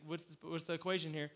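usual speaking voice

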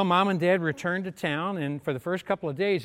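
usual speaking voice

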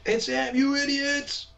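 high-pitched mock exasperated listener; mock yelling. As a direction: pretend to yell the answer at the radio. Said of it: "your listeners are yelling out his name".

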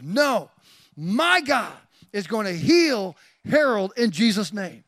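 loud, emphatic proclamation of faith; spontaneous declaration. Retold or imitated. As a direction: burst out with bold conviction. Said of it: "I just spontaneously said it".

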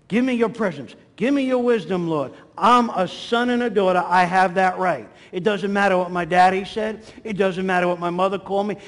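loud, insistent preaching in driving phrases; bold declaratory prayer. Real, not acted. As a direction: claim it out loud before God.

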